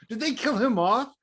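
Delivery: high, excited hope for a kill; mock excited hoping; hopeful wish. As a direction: raise it way up, eager. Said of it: "I was like".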